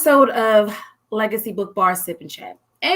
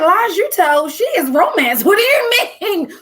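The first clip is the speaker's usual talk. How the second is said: laughing protest; 7 semitones higher than usual. Retold or imitated. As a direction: laugh off the insult while protesting. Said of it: "I said".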